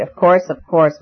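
ordinary delivery, nothing marked

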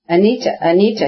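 narrated call to a wife, lightly projected. A narrator's reading. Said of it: "he said loudly".

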